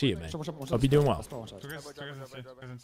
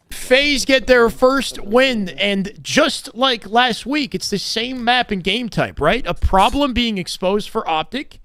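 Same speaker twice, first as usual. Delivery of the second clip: raised, drawn-out commentator delivery; esports caster recap; 7 semitones higher, 4 dB louder, slower than usual. Real, not acted. Caster telling viewers FaZe got their first win, commentator recap. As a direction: punch out the result, then ease down.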